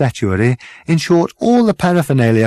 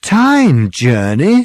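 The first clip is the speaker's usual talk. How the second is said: slow, rising disbelief; puzzled chorus; 6 semitones higher, 6 dB louder, much slower than usual. Acted. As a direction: repeat it back, baffled. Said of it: "said Zaphod, Ford, and Trillian all at once".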